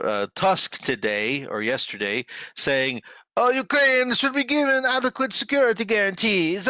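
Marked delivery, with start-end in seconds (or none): 3.4-6.7 put-on high-pitched botched French accent that comes out Italian; mock Italian accent. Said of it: "That's my Italian accent, not my French accent".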